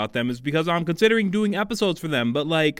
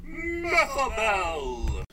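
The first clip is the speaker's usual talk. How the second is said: slow, drawn-out and higher; fighting game announcer; 6 semitones higher, 4 dB quieter, much slower than usual. Shown as a demonstration. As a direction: stretch the name like an arcade announcer.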